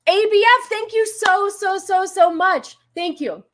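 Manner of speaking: high, loud gushing thank you; excited thanks. Real, not acted. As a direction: gush with delight.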